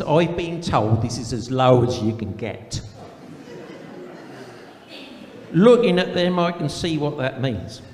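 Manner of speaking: put-on broad country accent, letter read aloud; put-on rural accent, comic storytelling. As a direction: read it as a rustic cousin with a rural English accent. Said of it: "best read in a rural accent".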